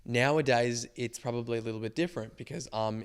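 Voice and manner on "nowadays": normal talking voice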